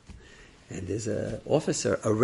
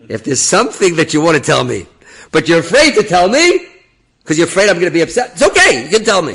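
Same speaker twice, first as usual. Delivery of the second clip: loud, raised voice, pressing each phrase; forceful urging; 7 semitones higher, 12 dB louder than usual. Retold or imitated. Rabbi urges officer at the gate, forceful and loud. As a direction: press him loudly to speak up.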